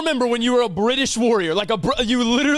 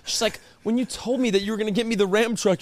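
ordinary talk

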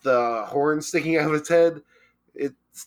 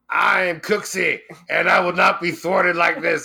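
a hint of a louder, pompous manager; mock cry out; 4 semitones higher, 4 dB louder than usual. The manager declaring himself before smashing, mock proclamation. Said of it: "He cried out".

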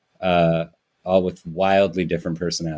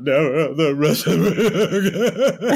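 laughing wail; 7 semitones higher, much slower than usual. Pretend. Mock crying at the lyric.

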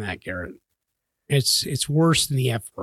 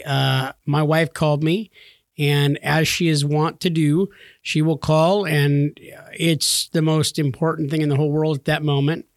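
ordinary talk

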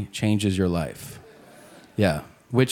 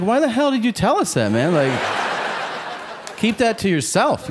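higher-pitched exasperated complaint; acted annoyance, pitched up; mock indignation; 7 semitones higher than usual. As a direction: protest like an annoyed passenger. Passengers object to pilot's announcement.

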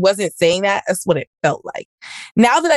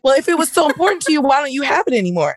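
higher, fast mock comeback; hammed-up defensive boyfriend; retold defensive retort; 6 semitones higher than usual. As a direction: toss it back quickly like a cornered boyfriend.

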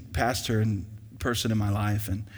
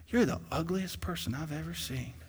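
deadpan parrot insult; 4 semitones higher, 9 dB quieter than usual. Acted. Parrot insults customer in low volume.

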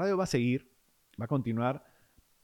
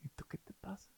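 scornful; 9 semitones higher, faster than usual. Retold or imitated. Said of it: "Y decía".

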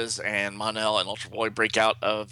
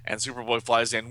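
plain reading voice

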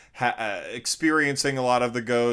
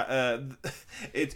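ordinary delivery

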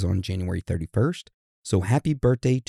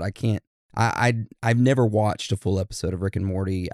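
usual speaking voice

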